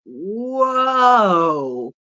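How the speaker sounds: loud, drawn-out whoa; big astonished whoa at the fall; amazed whoa. Retold or imitated. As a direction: gasp it out in awe. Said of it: "I'm like".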